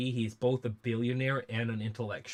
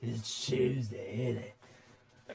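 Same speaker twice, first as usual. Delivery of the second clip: put-on grimy old geezer. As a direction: play a grimy old geezer greeting his partner. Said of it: "grimy old man geezer voice".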